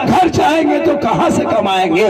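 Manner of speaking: crying prayer. Retold or imitated. Poor tanga driver weeping and crying out at the shrine. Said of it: "रोने लगा".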